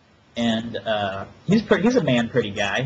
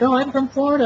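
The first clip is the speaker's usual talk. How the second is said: high, girly imitation of a stripper; squeaky ditzy voice, mocking her; 13 semitones higher, 4 dB louder than usual. Mimicking the stripper bragging about Florida, high and girly.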